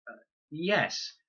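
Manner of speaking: Fred embarrassed about throwing dung; sheepish boy. Acted. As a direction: own up awkwardly.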